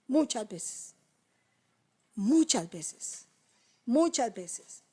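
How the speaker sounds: "muchas veces" slow and soft; slow reflective repeat. Shown as a demonstration. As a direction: let it sink in slowly. Repetición lenta reflexiva. ("muchas veces" lento y pensativo)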